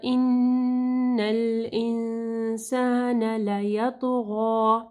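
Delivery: slow, drawn-out tajweed recitation; slow Quran recitation. Shown as a demonstration. Teacher demonstrating recitation to students, slow and drawn out. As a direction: recite each sound slowly and clearly.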